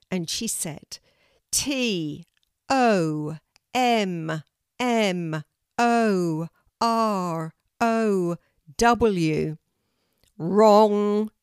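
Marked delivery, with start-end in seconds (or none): 1.5-9.6 long, loud, drawn-out letters; loud spelling out. A narrator's reading. Kelty attempts a spelling for the tree, loud and slow. 10.4-11.3 very loud, drawn-out verdict; booming tree teacher. A narrator's reading. Oak tree rejects Kelty's spelling, booming. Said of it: "said the teacher of the oak tree".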